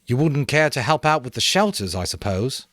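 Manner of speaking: high, loud casual invitation with an edge; mild veiled challenge. A narrator's reading. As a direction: invite with an undertone. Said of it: "invitation might have passed as casual".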